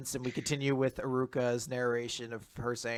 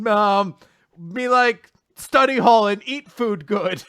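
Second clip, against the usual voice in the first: higher, louder fumbling broken English; mock broken English; 8 semitones higher, 12 dB louder, slower than usual. Host imagines answering the interview in bad English, higher and louder. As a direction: stumble through the English like a nervous student. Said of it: "questions that I don't know if I can answer in English".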